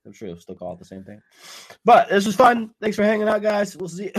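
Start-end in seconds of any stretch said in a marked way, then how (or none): 1.8-4.1 loud, high, upbeat outro; excited sign-off. Real, not acted. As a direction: wrap up the show with a big cheer.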